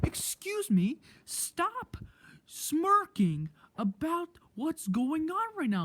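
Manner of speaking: high-pitched, drawn-out indignant scolding; imitated angry teacher. Teacher turning back to tell off a student, mock indignant. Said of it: "she's like".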